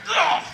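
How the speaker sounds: high, loud "ugh"; hammed-up groan of disgust; exasperated groan. Park visitor reacting to yet another line, exaggerated groan.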